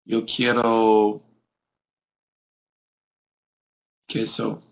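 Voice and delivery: slow, drawn-out Spanish words; Spanish phrase. Shown as a demonstration. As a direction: say it slowly in Spanish, on request. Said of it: "Say something in Spanish".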